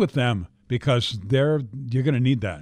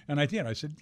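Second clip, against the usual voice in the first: ordinary talk, nothing marked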